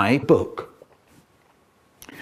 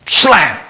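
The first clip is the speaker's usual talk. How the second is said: loud punchy slam; imitated door slam; 5 semitones higher, 9 dB louder than usual. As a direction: bang it out like the door.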